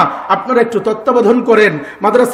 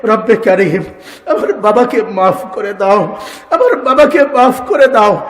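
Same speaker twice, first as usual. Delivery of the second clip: imitating a child's tearful prayer; tearful plea. Children praying over their dead father's body, tearful pleading. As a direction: beg God through held-back tears, voice lifting on the repeat. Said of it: "চোখের পানি ছেড়ে দিয়ে বলবে".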